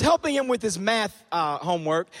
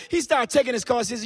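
ordinary talk

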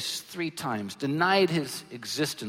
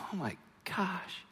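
despairing whisper; 8 dB quieter than usual. Retold or imitated. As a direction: breathe it out like you just ruined everything. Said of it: "and you go".

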